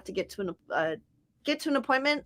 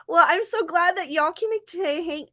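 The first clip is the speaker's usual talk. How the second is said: slipping into a Southern accent, higher pitched; accidental touch of Southern accent; 6 semitones higher than usual. Real, not acted. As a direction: greet folks with a high Southern lilt. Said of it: "stop with the Southern accent".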